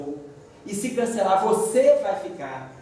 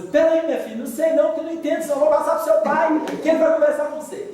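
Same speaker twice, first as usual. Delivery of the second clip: higher, louder, fast flustered wife; mother passing the phone to dad, higher and hurried; wife's voice; 10 semitones higher, 5 dB louder, faster than usual. Retold or imitated. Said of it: "E aí minha esposa".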